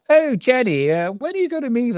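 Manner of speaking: high, sing-song mock of a fussy man; squeaky put-on voice to poke fun at him; mocking the BBC guy. Lighting man mimics the difficult BBC guy, high sing-song mock.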